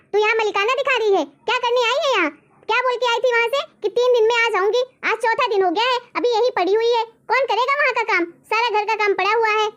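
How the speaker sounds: high-pitched angry scolding; angry yelling. Acted. Daughter-in-law berating her mother-in-law over unfinished housework, angry yelling. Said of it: "यहाँ क्यों ऐसे चिल्ला रही है?".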